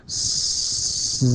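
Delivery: long drawn-out hissing s turning buzzy; sustained hiss demo. Shown as a demonstration. Teacher showing students vibration in the vocal cords, sustained sibilant demo. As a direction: hold the sibilant, switching voicing on and off. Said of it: "لاحظ مثلًا تقول".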